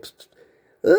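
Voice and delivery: faint "psst" of secret talk; voiceless mimicked whisper noise; imitated whispering. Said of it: "They're whispering back and forth".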